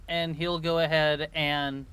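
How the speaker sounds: ordinary talk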